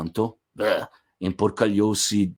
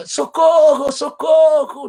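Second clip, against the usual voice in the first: much higher, louder yell for help; shout for help; 7 dB louder, slower than usual. Retold or imitated. Andreuccio yelling from the pit, high loud shout. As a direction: bellow it up from the bottom of the pit. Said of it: "o Andreuccio lá grita".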